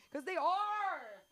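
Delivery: slow, high, reluctant "are"; drawn-out admission. Acted. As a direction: stretch out the painful truth. Kids funnier, drawn-out admission.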